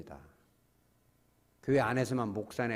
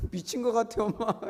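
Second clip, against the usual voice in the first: put-on teasing child; 10 semitones higher, 4 dB louder than usual. Child teasing mom, voiced in sermon.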